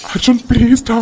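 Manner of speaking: ordinary delivery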